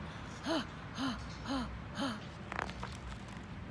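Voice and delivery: breathless panting; huffing. Acted. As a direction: pant hard, out of breath. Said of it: "started huffing".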